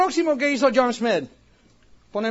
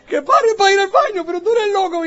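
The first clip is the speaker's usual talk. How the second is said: laughing mockery; 7 semitones higher, 3 dB louder than usual. [name] laughing, mocking him.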